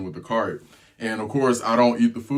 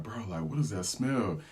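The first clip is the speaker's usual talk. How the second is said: hushed murmuring; 9 dB quieter than usual. Retold or imitated. Passengers quietly wondering about a mystery stink, hushed. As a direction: keep it down like gossiping passengers. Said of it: "people's kinda whispering around".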